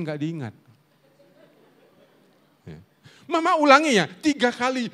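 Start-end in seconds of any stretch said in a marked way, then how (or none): 3.3-4.9 high, loud mock-mom nagging; hammed-up scolding mom, pitched way up; nagging mother. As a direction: play a mother repeating her orders.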